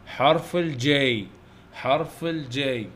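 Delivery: drawn-out, measured naming; slow letter drill. Shown as a demonstration. Teacher naming the letter J for young pupils, slowly. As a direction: stretch it out for pupils to repeat.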